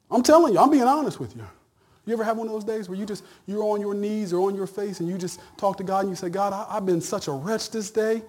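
high, loud preaching; loud earnest sermon build; preaching at full force. Acted. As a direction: raise it up with fervor. Preacher rises again.

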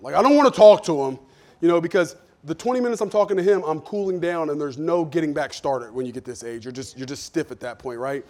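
ordinary talk

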